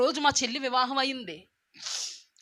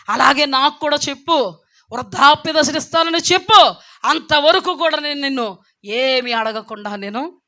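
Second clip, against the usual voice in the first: fervent prayer; 3 semitones higher, 7 dB louder than usual. Retold or imitated. Woman retelling her prayer to God, loud pleading prayer. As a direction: cry out to God, demanding an answer.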